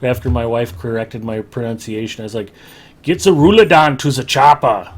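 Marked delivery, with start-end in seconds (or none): 3.0-4.9 loud Schwarzenegger accent, barked at the chopper. Shown as a demonstration. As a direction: shout it in a thick Austrian Schwarzenegger accent. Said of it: "And I nailed it pretty good".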